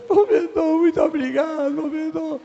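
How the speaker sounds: high, tearful cry on the thanks; retold sobbing. Young intern thanking the director for the job, weeping. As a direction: sob out the gratitude. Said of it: "e eu chorando copiosamente".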